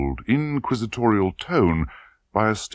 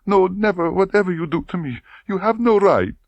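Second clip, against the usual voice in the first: sobbing; 11 semitones higher, 3 dB louder than usual. A narrator's reading. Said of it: "she cried between her sobs".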